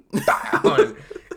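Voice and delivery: loud high-pitched burst of laughter. Real, not acted. Laugh after mock scream.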